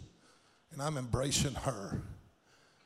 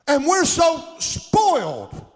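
loud, high, stretched-out shouting praise; preaching at full force; 11 dB louder than usual. Acted. Preacher shouting to the congregation about God's blessings, full force. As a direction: lift the whole church with a ringing shout.

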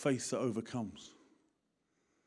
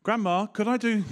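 put-on pleading kid; 7 semitones higher, 6 dB louder than usual. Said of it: "if it goes really badly".

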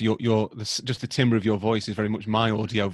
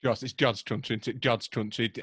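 softer, put-on Yorkshire accent; mock Yorkshire farmer; 6 dB quieter than usual. Podcaster playing a farmer praising local country, softly imitated Yorkshire accent. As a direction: play a Yorkshire farmer musing under the chat. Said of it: "you're gonna go for a local Yorkshire farmer".

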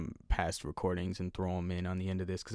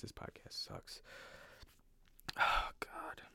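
whispered, sighing despair; defeated whisper; 12 dB quieter, slower than usual. Real, not acted. Host giving up on his own podcast, despairing whisper. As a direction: whisper it to yourself, fed up.

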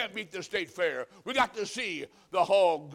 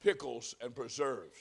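mock grand reveal; 5 semitones lower, 4 dB quieter than usual. Mock big reveal at the fair.

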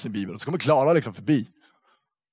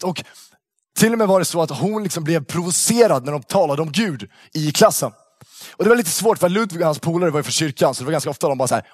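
ordinary delivery

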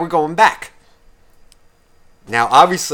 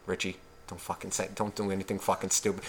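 much softer, urgent under the breath; hushed warning; 6 semitones lower, 15 dB quieter than usual. Retold or imitated. Buddy warning Richie under his breath, hushed. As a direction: warn him quietly so the salesman won't hear.

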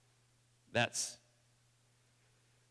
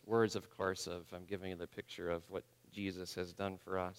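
ordinary talk

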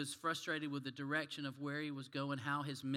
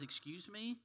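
higher, softer, rising question; puzzled, caught off guard; startled reply; 5 semitones higher, 5 dB quieter than usual. Acted. Startled rabbi answers a soldier, puzzled and higher. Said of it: "the rabbi replies".

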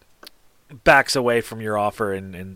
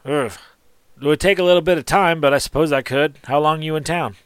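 a touch higher and louder shopkeeper; lightly voiced shopkeeper; 5 semitones higher, 5 dB louder than usual. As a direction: lightly play the obliging trader. Game master voices the shopkeeper.

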